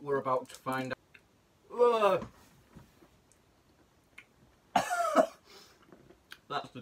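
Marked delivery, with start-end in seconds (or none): 1.8-2.3 high, loud grimacing ugh; sour disgusted groan. Real, not acted. Sour green apple candy. 4.7-5.3 coughing. Real, not acted. Sour candy makes him cough.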